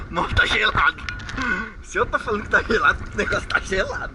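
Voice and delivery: loud insistence. Real, not acted. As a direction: hammer home that it's cold. Insisting the water is cold.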